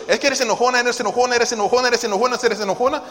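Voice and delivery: tono agudo y fuerte, en cadena; reproche repetido. Retold or imitated. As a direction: machaca la acusación una y otra vez. Mimics a repeated nagging accusation. (imitación de regaño insistente)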